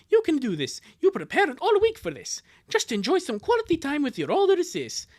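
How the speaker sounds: high, animated pep-talk voice; self-coaching boy. Acted. Boy preparing for time with older sis, pep talk. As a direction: psych yourself up as a cartoon boy.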